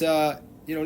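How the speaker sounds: usual speaking voice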